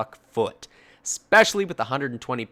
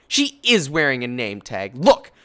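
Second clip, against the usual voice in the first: huffy retort; 3 semitones higher, 7 dB louder than usual. Retold or imitated. Customer argues back.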